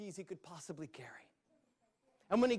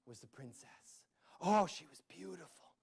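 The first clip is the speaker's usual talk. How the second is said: ordinary delivery, nothing marked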